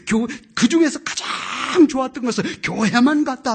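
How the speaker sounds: loud, rising preacher's cry; preaching at full force. Acted. Preacher to the congregation, loud and exultant. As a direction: preach it out to the hall.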